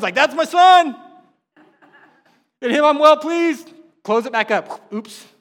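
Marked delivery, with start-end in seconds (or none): 0.1-3.7 much higher and louder, slow, a voice from heaven; proud heavenly father thundering from above; booming outside voice. Acted. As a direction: boom it out so the earth shakes. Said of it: "That was my outside voice".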